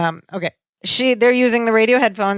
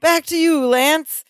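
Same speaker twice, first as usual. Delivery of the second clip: announcer voice; 6 semitones higher, slower than usual. Retold or imitated. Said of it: "so he goes".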